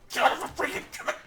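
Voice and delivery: shrill-free but loud high outburst at a failing check; exasperated shout. Real, not acted. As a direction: explode at the game. Begging the dice to pass.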